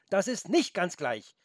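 indignant. A narrator's reading. Felix corrects the careless goat, indignant. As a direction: object with a touch of outrage. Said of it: "rief Felix entrüstet".

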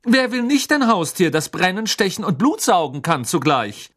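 high, loud sarcastic sneer; mocking Malfoy. A narrator's reading. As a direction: ridicule the idea of pets. (spöttischer Malfoy, hoch und laut)

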